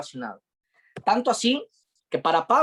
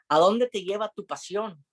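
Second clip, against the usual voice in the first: ordinary delivery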